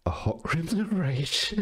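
high voice giving way to laughter; real giggly reading; corpsing. Real, not acted. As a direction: try to read on while cracking up. Cracks up while reading.

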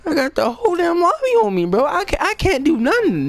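squealing falsetto complaint, very high; comic high-pitched panic. Acted. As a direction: whine it at the top of your range, hammed-up panic. Comic panic at being targeted.

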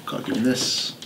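hurried whisper; whispered aside. Retold or imitated. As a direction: rush it under your breath. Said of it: "and then just went".

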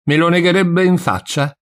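high, loud incredulous question; astounded. A narrator's reading. Old servant hears the priest deny it, astounded. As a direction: challenge in shocked disbelief. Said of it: "stupita, trasecolata".

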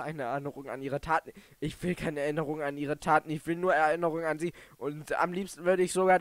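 ordinary talk, nothing marked

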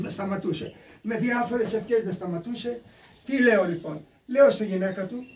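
usual speaking voice

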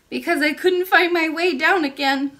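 put-on high, loud little-girl voice; child princess. A narrator's reading. Princess explains her tears to the old lady, childlike. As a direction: pitch it up as a small princess.

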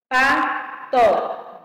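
"Pato" broken into two firm beats, pa-to; syllable by syllable. Shown as a demonstration. Teacher counting out two syllables for pupils, syllable by syllable. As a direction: split "Pato" into separate syllables with a gap. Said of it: "separação de palavras e sílabas".

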